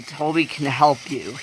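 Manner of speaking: ordinary delivery, nothing marked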